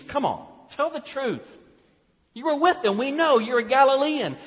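high, loud, pressing accusation; accusing bystander. Acted. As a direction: corner him with the accusation. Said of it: "It means".

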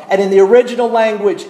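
ordinary talk, nothing marked

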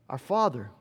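ordinary delivery

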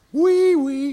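high sing-song holler, imitated; mama's calling voice. Mama calling her boy in from play, high imitated holler. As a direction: call out a pet name in a high mother's voice. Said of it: "you hear this voice say".

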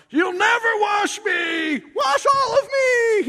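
very high, very loud slow hammed-up shout; mock Peter shouting. Preacher mocking Peter's whiplash to the congregation, shrieking high. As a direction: ham up a shrieking overreaction.

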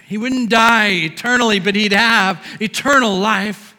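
loud, slow, exultant personal testimony; full-force preaching of the verse; fiery preaching. Acted. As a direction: proclaim the verse as your own. Testimony recital loudly preached.

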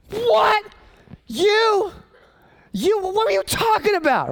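very high, disbelieving squeak; the king can't believe it's Haman; shocked king. Acted. The king turns on Haman, high shocked disbelief.

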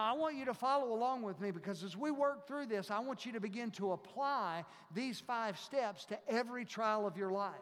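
usual speaking voice

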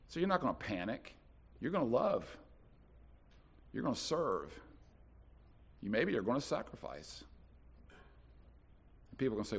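usual speaking voice

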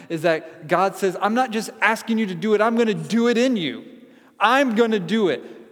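loud, high, urgent sermon; preaching at full force. Acted. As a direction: drive the promise home to the church. Preaching climax.

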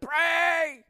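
high, loud cry of pray; shout. Retold or imitated. Preacher imagining a shout on a plane, loud cry. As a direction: shout it like a sudden plea. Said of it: "I have this urge sometimes to shout".

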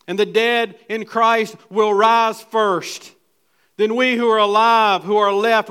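preacher declaiming First Thessalonians; preaching at full force. Acted. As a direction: proclaim the rapture passage. Reading scripture at full force.

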